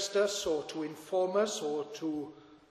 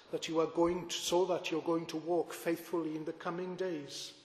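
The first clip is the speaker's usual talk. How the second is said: usual speaking voice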